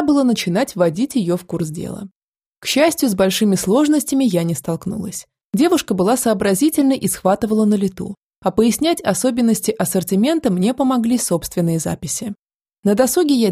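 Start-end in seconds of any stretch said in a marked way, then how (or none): none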